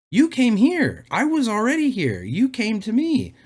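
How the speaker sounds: high-pitched, exasperated insistence; indignant protest. Real, not acted. Telling off a commenter who came to his channel, indignant.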